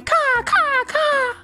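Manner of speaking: high cawing crow call. Acted. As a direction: caw like an alarmed crow. Crow crying alarm.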